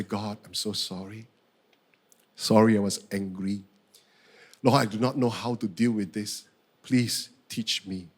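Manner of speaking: subdued, sincere pleading; calmed down. Retold or imitated. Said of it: "And so I calm down".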